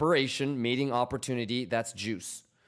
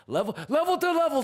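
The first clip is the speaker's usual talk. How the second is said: much higher, fast, squeaky put-on voice; 14 semitones higher, faster than usual. Goofing to chat in a squeaky high fast voice. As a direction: squeak it out like a cartoon.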